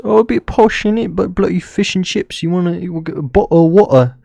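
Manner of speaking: high, loud mocking Essex accent. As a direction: mimic British relatives in an exaggerated, high-pitched Essex accent. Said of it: "They literally talk like that".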